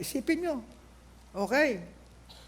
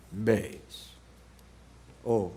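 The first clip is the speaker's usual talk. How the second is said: much lower, softer mock bass note; 13 semitones lower, 6 dB quieter than usual. Shown as a demonstration. Preacher shows the congregation his bass voice, deep and low. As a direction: drop into a deep singer's bass. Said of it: "medyo malaki boses ko ngayon? Bass ako ngayon eh".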